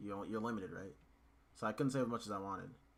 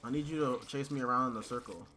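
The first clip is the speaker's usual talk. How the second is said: normal talking voice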